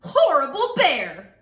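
loud, forceful indignant yell; stomping girl's shout. A narrator's reading. Girl stomping all the way home, angry shout. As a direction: punch out both words in a cross yell. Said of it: "She stomped all the way home".

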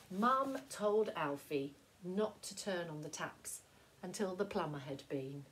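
ordinary delivery

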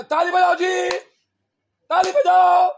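high shouted call for applause; rally the crowd at full voice, stretching the last call; shouted demand. Real, not acted. Speaker to crowd demanding claps, high shouting.

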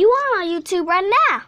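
loud, high excited yell; excited shout. Real, not acted. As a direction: shout it out, thrilled. Repeating reveal to friend.

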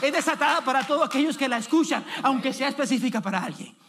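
loud, high, shouted promises to the congregation; fiery prophetic proclamation, building to a shout; full-force preaching. Acted. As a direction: preach it at the top of your voice, rising.

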